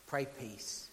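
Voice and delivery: ordinary talk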